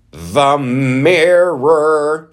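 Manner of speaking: high, loud, drawn-out announcement; mock-dramatic reveal. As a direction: stretch it out like a grand reveal.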